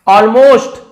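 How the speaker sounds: teacher's loud high model of Almost; loud model word. Shown as a demonstration. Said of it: "उसे almost कहा जाता है".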